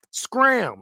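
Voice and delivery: high mock cartoon shoo-off; cartoon scram. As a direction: squeak it out like a cartoon character. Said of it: "That is a cartoon".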